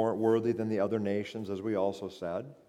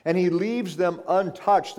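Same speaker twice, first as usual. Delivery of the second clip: raised preaching voice, "untouched" hit hard; stress on untouched; 8 semitones higher, 6 dB louder than usual. Real, not acted. Preacher says God leaves sinners alone, stressing "untouched". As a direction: lean firmly on "untouched". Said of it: "I emphasize the word untouched".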